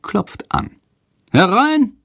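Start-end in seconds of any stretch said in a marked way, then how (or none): 1.3-1.9 high, odd headmaster voice through a door; headmaster's voice. A narrator's reading. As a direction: call it out as the headmaster. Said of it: "Direktor Lersikos Stimme durch die Tür".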